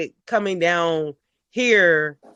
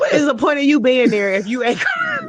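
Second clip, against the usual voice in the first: mock indignant; 5 semitones higher, 4 dB louder than usual. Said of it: "I'm like".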